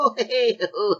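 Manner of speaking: high impish cackle imitating a leprechaun; cheeky little goblin giggle; leprechaun laugh. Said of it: "my shit impression of a leprechaun laugh".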